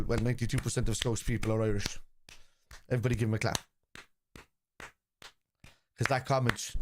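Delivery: ordinary talk, nothing marked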